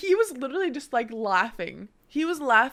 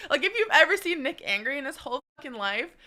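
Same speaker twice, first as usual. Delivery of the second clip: ordinary talk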